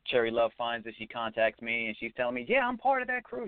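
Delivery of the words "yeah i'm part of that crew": very high-pitched excited imitation; high, thrilled impression of a friend. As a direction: mimic an excited woman, pitch shooting way up. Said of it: "in her excited voice".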